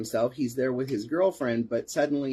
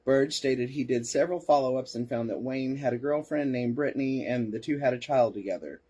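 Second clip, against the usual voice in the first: usual speaking voice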